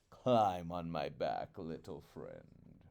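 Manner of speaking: low, slow sly vulture. Acted. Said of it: "he said".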